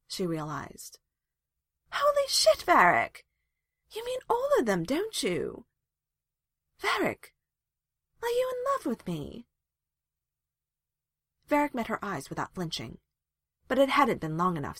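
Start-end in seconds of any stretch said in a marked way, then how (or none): 1.9-9.5 high, incredulous outburst; amazed, very high-pitched questioning; stunned realization. A narrator's reading. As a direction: blurt it out in astonishment. Stunned realization, confronting him.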